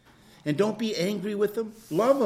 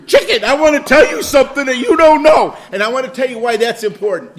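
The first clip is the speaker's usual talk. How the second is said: much louder and higher, talking grandly to a raw chicken; mock speech to a chicken; 7 semitones higher, 14 dB louder than usual. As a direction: preach loudly at the chicken. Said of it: "talk to it".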